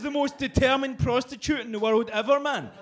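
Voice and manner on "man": ordinary talk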